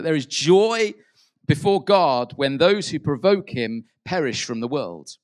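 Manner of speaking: loud, slow, harsh pronouncement; stern Pharisee. Retold or imitated. Quoting Pharisees to a congregation: loud stern decree. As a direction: proclaim it like a judging Pharisee. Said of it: "a common phrase in the Pharisees".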